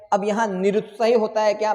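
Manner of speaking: ordinary talk, nothing marked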